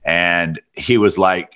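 ordinary delivery

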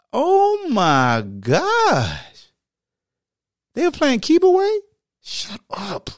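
very slow, high "oh my gosh"; stunned and mocking; drawn-out shock. Real, not acted. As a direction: stretch it out in disbelief. Shocked at possession stat.

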